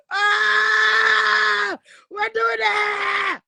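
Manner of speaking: high, loud drawn-out holler; re-enacted pain yell. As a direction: scream it out like a freshly stubbed toe. Re-enacts yelling after stubbing toe.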